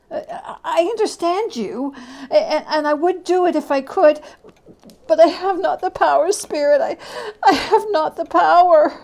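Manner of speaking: a man too afraid to look; distressed Scrooge. Acted.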